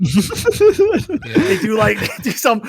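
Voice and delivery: high laughing rant. Real, not acted. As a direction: laugh through the complaint. Laughing rant about ambient openers.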